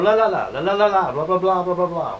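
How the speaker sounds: high, lilting mock Italian babble; Italian accent. Actor recalling his opera star role, mock Italian accent. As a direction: swoop up and down like a stage Italian. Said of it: "I had to talk like an Italian".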